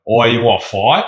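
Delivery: playful Australian challenge to a sparring partner; Australian accent. Acted. Student picking a jiu-jitsu partner, mock Australian accent. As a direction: put on an Aussie accent to pick a friendly fight. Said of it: "they just talk in an Australian accent".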